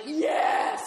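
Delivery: high, loud cry of triumph; exultant shout. Retold or imitated. Stoned woman answering a cheering party, exultant shout.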